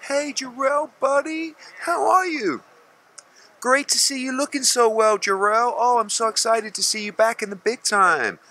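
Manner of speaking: hammed-up falsely warm greeting; mock fake-friendly gushing. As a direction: mimic boxing people sucking up to him. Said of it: "this is most people in boxing".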